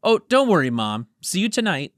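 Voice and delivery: eager son to his mom on the phone; eager reply. Acted. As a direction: brush it off keen to get going. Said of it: "Juan replied eagerly".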